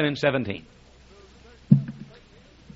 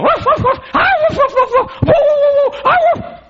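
very high-pitched frantic little-dog barking; shrill falsetto woofs and howls; yapping chihuahua; 4 dB louder than usual. Acted. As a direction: yap like an excited tiny dog. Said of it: "the dog comes out going".

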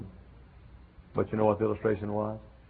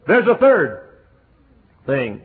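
high, loud announcement; preacher's shout; 5 semitones higher, 11 dB louder, slower than usual. Acted. Preacher shouts his third point to the church.

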